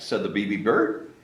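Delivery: plain reading voice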